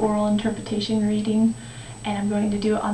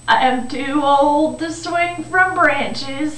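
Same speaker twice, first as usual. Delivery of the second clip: put-on slow, louder elderly voice; old man; 5 dB louder, slower than usual. The aged boy tells the tree he's too old, elderly voice. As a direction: draw it out like a weary old man.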